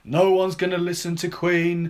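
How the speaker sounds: slow, high mock pronouncement; sceptical record boss. Head of EMI dismissing the band, slow mock pronouncement. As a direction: play the doubting label head very on the nose. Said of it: "the head of E M I".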